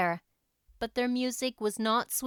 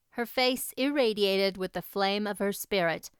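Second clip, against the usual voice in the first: plain reading voice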